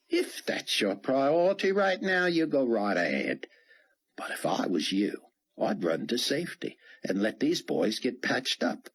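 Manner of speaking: casual stranger talking the commander into leaving; lightly voiced folksy helper. Dart to Fortunato, a lightly voiced casual helper.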